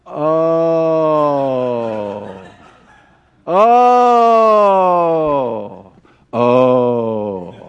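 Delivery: long held oh, rising then dropping low; finally understanding in heaven; dawning realization. Retold or imitated. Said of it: "we're all gonna be like".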